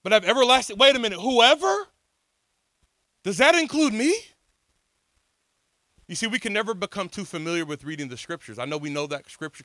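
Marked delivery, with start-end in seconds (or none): 0.0-4.1 amazed wonder. Acted. Preacher marvels at scripture.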